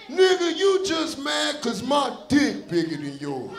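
put-on high, drawn-out taunt in a boyish voice; slow, high-pitched imitation of someone yelling a taunt; mock yell. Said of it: "she gonna yell out".

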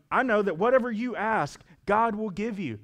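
high, loud, earnest quoting; eager theologian. Retold or imitated. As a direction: push it up with eager certainty. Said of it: "She's like".

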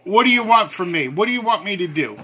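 ordinary talk, nothing marked

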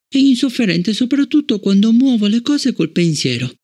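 put-on high young girl's voice, hurt and puzzled; a child asking why she is disliked; child Fallon. Fallon asking Bliss about Travis, high child voice.

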